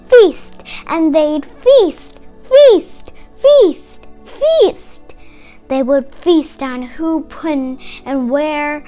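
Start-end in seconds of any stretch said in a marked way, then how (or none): none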